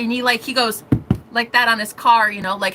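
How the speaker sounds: normal talking voice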